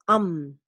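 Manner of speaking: "um" with a short neutral vowel and humming m; isolated final syllable. Shown as a demonstration. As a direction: isolate the "um" that ends autumn, lingering on m. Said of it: "este sonido". (um, final de autumn, con la m sostenida)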